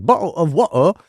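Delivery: exaggerated British, bottle and water with dropped t's; mock British accent. Dad imitating his kids, hammed-up mock British accent. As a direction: lift it into a cartoonish British glottal-stop accent. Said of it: "anybody who actually is British".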